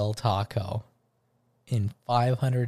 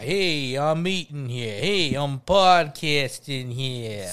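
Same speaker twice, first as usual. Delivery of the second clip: put-on slow, drawn-out New York accent; hammed-up New York street talk; mock New York accent; much slower than usual. Co-host riffing on New York clichés, mock New York accent. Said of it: "he was from New York".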